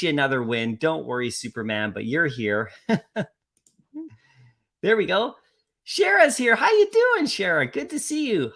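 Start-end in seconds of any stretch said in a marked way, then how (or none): 5.8-8.5 excited greeting. Real, not acted. Streamer greets arriving chatter.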